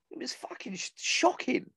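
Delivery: whispered, appalled; hushed outrage. Real, not acted. Telling the wives' story, whispered in shock. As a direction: breathe it out in disbelief.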